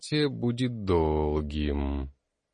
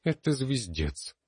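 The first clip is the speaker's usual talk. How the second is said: short and blunt; 7 semitones higher, slower than usual. A narrator's reading. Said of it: "коротко и ёмко".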